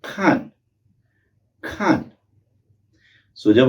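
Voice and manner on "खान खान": खान starting high and falling sharply; falling fourth tone. Shown as a demonstration. Teacher models खान with a sharp fall. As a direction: drop hard from high on खान. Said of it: "So ये बना".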